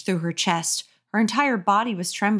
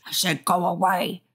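ordinary delivery

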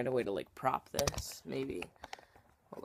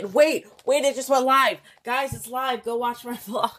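loud, high excited announcement; excited outburst; 9 semitones higher, 10 dB louder than usual. Real, not acted. Vlogger sees the video just went live, excited shout-out. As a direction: burst out with sudden good news.

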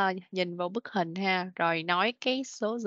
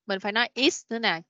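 ordinary delivery

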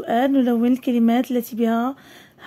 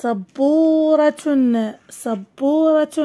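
slow, long middle vowel; deliberate dictation to young pupils; drawn-out word reading; 2 semitones higher, much slower than usual. Shown as a demonstration. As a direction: read it out syllable by syllable. Teacher sounds out word for pupils.

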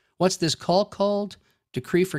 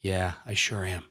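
low, slow, soft reply; Fifi; 11 semitones lower, 7 dB quieter than usual. Acted. Preacher voicing a sufferer's answer, low and slow. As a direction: act a weak person answering, low and drawn out. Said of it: "Fifi over there".

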